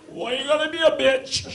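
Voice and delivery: high, loud squeaky frog-like voice with a laugh; roommate's frog voice. Retold or imitated. Roommate imitating a frog at the TV, high and loud. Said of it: "he'll just go".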